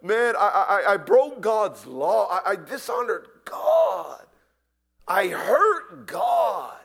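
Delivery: loud, rising anguished confession; full-voiced penitent shout; broken anguished cry. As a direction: cry it out as a sinner who hurt God. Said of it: "When you're broken because you know".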